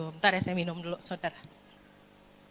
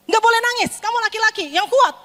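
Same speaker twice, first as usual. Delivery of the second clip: loud, high parental command; stern parent; 10 semitones higher, 4 dB louder than usual. Acted. Parent ordering a crying son to be strong, stern. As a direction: drill toughness into him, high and emphatic.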